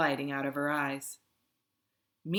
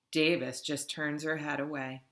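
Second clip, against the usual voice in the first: ordinary delivery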